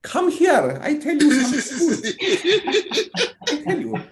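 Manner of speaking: high, slow mock-threatening mum; imitating someone's mum. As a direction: play a scolding mum calling the kid over. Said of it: "imagine his mum talking like that".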